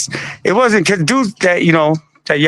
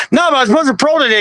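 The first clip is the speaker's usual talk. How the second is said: fast, higher, rushed disbelief; blurting it out in a hurry, a mock panic; panicked protest; 8 semitones higher, much faster than usual. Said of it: "And I was like".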